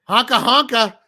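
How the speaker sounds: goofy clown honk call; silly clown. Acted. Declaring himself a fan.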